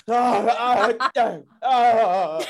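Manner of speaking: repeated loud guttural "Ah"; mock the groans of a fallen mum; guttural cries. Mum fallen on the ground, loud guttural cries. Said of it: "the worst guttural".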